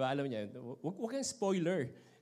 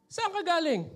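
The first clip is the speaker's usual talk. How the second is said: high, loud demand; scolding mother; 11 semitones higher, 6 dB louder than usual. Retold or imitated. Mother demands where her son has been, high and loud. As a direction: snap the question at the kid.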